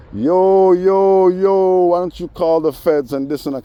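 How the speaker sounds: high, loud yelling call-out; hollered taunt from a window; retold yell. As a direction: shout it across the street like the neighbour did.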